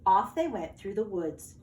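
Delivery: ordinary delivery, nothing marked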